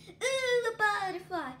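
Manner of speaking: suddenly distracted by something pretty; a bright sudden "ooh" pitched well up; delighted ooh.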